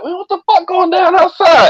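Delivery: very high, startled outburst; alarmed disbelief. Retold or imitated. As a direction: sound caught off guard and rattled. Narrator's alarmed retold question.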